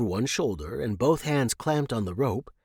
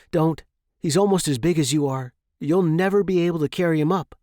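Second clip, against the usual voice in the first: put-on higher, louder, fast young man pleading; Jeremy stopping the gray, urgent and fast; 6 semitones higher, 5 dB louder, faster than usual. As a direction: hold her back with quick urgent words. Jeremy warns the dragon.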